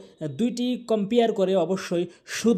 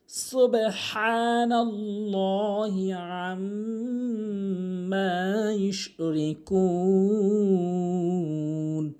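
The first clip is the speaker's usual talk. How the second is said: slow, drawn-out chanted recitation; Quran recitation; much slower than usual. Shown as a demonstration. Teacher reciting the verse slowly for students.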